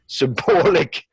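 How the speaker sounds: ordinary talk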